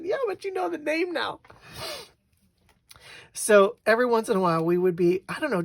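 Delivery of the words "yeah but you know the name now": high-pitched, amused comeback; teasing retort. Retold or imitated. Wife answering husband's refusal, teasing. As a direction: toss it back in a high teasing voice.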